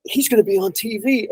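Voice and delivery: high, thrilled exclamation; excited disbelief. Retold or imitated. To Jason, as the hot dog man is called in: high excited. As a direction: squeal it in amazement.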